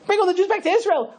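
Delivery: high-pitched, incredulous echo; shocked wife. Acted. Wife repeats her husband's news, shocked and high.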